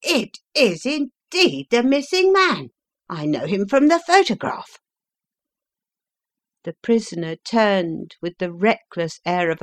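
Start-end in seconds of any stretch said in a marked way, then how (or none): 0.0-4.8 higher, louder, astounded; excited. A narrator's reading. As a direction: blurt the discovery. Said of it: "cried the inspector".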